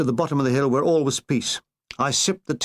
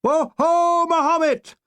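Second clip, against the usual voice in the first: high, loud whooping yell; battle cry again; 13 semitones higher, 3 dB louder, much slower than usual. Retold or imitated. Troops cheering the downed plane, loud whooping battle cry. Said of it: "we yelled".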